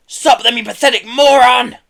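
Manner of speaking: high, very loud furious screech. A narrator's reading. Pelly berating Herb as van leaves, screeching. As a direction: screech abuse and orders together. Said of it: "screeched Pelly".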